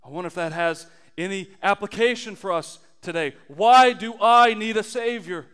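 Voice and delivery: normal talking voice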